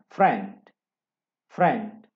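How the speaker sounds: friend said loud with a short e; model word. Shown as a demonstration. Teacher drilling the class on friend, loud and clear. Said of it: "dibacanya E".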